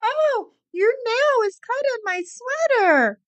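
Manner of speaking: very high, whiny, crying mock complaint; imitating Jen whining about the dog's nails, very high fake cry; mock whiny owner. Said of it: "and you're like".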